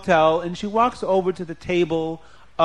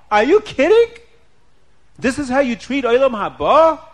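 acted outrage, rising high and loud; indignant scolding with incredulous questions; upset, angry wife; 7 semitones higher, 6 dB louder than usual. A narrator's reading. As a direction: start stunned, then scold in a high, loud voice. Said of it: "Is she angry at him?".

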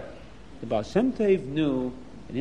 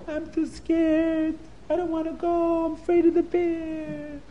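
high, small frightened voice; scared child; 11 semitones higher than usual. Acted. Scared boy refusing his teacher, high and timid. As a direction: whimper that you won't go.

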